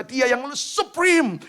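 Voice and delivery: "supreme" drawn out slow, high and loud; slow proclamation. Acted. ("supreme" diucapkan lambat dan lantang)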